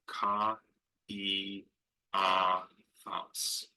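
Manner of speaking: "ka, e, a, thos" in four separate syllables; vowels pronounced separately. Shown as a demonstration. Teacher sounding out a Greek name, "ka, e, a, thos" syllable by syllable. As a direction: break "ka, e, a, thos" apart, a pause after each vowel. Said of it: "need to be pronounced separately".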